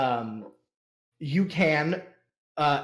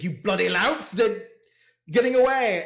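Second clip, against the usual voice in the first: rising, louder indignant rant; indignant noble; 6 semitones higher, 4 dB louder than usual. Acted. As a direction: splutter at the fleeing louts. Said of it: "he's like".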